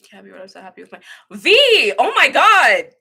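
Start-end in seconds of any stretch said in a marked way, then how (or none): 1.3-2.9 high, loud, swooping gush; excited exclamation. Real, not acted. Streamer so happy with viewer V, excited exclamation. Delighted reaction to a viewer's message.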